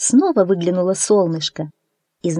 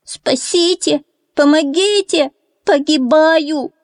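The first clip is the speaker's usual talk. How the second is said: much higher desperate calls, slowing; terrified cry for help; 9 semitones higher, 4 dB louder, slower than usual. A narrator's reading. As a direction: call for rescue in fright. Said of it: "перепугался утёнок".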